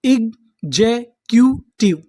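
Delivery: इग्जैक्यूटिव said very slowly; slow sounding out. Shown as a demonstration. Teacher to students, slow syllable demo. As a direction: spell इग्जैक्यूटिव out slowly, part by part. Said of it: "तो हमारी पूरी meaning क्या बनी".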